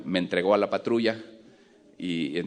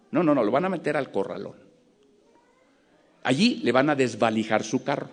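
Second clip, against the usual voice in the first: pushy cop; 5 semitones higher, 3 dB louder than usual. Retold or imitated. Policeman pressuring him to pay bribe.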